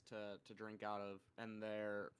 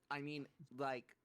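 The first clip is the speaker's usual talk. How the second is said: ordinary talk, nothing marked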